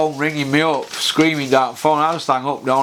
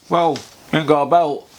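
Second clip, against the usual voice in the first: normal talking voice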